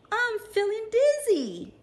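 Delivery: high, loud little bug voice; high ladybug voice. Acted. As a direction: whine dizzily in a tiny voice. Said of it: "said the lazy ladybug".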